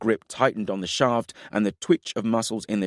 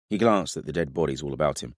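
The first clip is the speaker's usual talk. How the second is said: ordinary delivery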